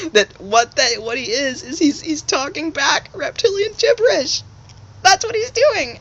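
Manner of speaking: high, loud, cracking with laughter; can barely get it out for giggling; laughing through the explanation, pitched high; laughing hard. Real, not acted.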